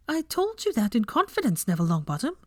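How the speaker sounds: high, sharp rebuke; snapped. Acted. Hermione snapping at Neville for telling, sharp high rebuke. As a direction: scold him for blabbing. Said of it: "she snapped".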